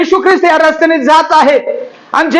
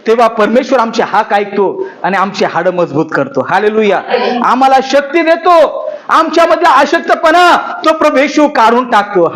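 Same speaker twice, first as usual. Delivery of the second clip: hushed sermon storytelling; 6 semitones lower than usual. Acted. Closing exhortation, lower and softer. (हळू, खालच्या आवाजात उपदेशकाची कथा)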